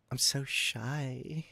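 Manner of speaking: hammed-up shy girl too timid to phone; mock shy. Streamer mocking a shy caller, soft, slow, put-on voice.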